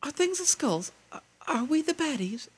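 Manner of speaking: very high, hesitant mock-innocent voice; doubting bad guy. Nazi noticing the skulls on the hats, dawning doubt.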